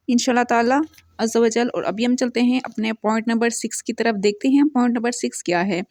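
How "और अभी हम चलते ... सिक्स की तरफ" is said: usual speaking voice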